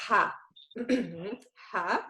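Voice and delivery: "Ha" breathed deep from the throat like steaming glasses. Shown as a demonstration. Teacher demonstrates the deep Arabic Ha. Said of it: "that's how deep it is".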